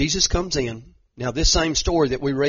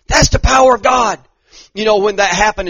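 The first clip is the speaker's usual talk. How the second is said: much higher, louder revival cry; preaching at full force; 6 semitones higher, 11 dB louder than usual. Acted. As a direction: shout the testimony out. Preaching exclamation.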